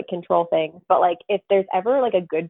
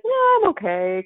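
reluctant self-quote; 11 semitones higher, 3 dB louder than usual. Acted. Guest voicing the part of herself that declines dream visits, high and reluctant. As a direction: voice your own reluctance, pitched well up.

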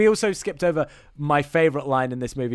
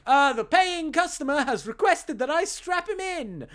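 mock official call; 10 semitones higher than usual. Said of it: "gives himself an out by shouting up".

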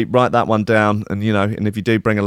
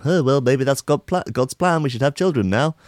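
higher, hammed-up dreamy girlfriend; lightly voiced partner dreaming of a baby; mock girlfriend; 5 semitones higher than usual. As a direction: girlfriend suggests the broken condom is God's plan, mock-sweet. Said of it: "you could just go".

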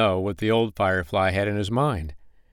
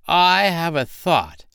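slow, higher, self-important pronouncement; clever goblin; 9 semitones higher, 4 dB louder, slower than usual. Acted. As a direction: announce the idea grandly. Goblin has an idea.

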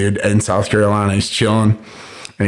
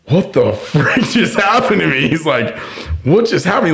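high-pitched imitation of stunned disbelief; mimicked bewilderment; 6 semitones higher than usual. Retelling a friend realizing he's been shot, bewildered. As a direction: act out baffled shock, half laughing.

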